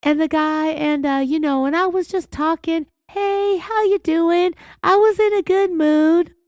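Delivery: put-on high-pitched greeting voice; high-pitched fake-friendly voice. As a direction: imitate her high, friendly chirp. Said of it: "upped the pitch of her voice and said".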